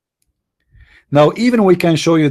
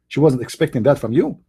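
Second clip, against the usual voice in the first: ordinary talk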